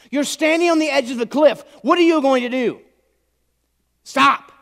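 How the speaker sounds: high, loud shouted warning; urgent full-voiced shout; God screaming. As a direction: yell it to halt someone at a cliff edge. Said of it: "God was screaming at me".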